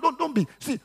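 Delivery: normal talking voice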